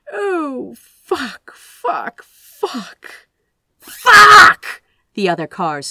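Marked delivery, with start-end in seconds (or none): 0.1-3.3 high, rising panic, fading to a breathy sob; each curse more desperate than the last; panicked cursing. A narrator's reading. Aziraphale watching his car tumble, panicked cursing. 3.8-4.8 full-throated high scream; screamed curse. A narrator's reading. Aziraphale after crashing the car, screaming a curse.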